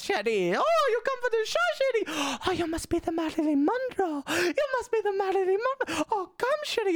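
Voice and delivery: very high, theatrical grande dame voice; lilting high diva voice welcoming a guest; drag mum Yuvelia. Retold or imitated. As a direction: sweep up high and purr like a grand old drag mother. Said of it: "she talks like this".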